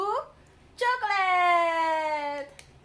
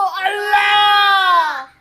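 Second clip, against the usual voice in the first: excited shout; 4 semitones higher, 13 dB louder than usual. Real, not acted. Cheering child's photo.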